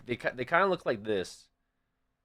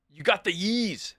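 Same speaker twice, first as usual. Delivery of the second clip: slow, higher mock bro drawl; hyped bro voice; 7 semitones higher, slower than usual. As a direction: lightly play the excited sneaker bro. Guy points at matching shoes.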